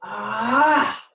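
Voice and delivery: loud, rising "ah" of anger; a loud, venting groan; angry release cry. Shown as a demonstration. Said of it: "I feel angry".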